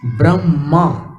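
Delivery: ब्रह्मा with a clear h before the m; Sanskrit pronunciation. Shown as a demonstration. Teacher demonstrating the Sanskrit ब्रह्मा, h first. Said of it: "ह पहले आता है".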